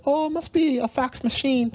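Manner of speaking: put-on Bajan accent grandma. Grandson quoting his Barbadian grandma about the box, in a high Bajan accent. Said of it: "so I have this Bayesian accent".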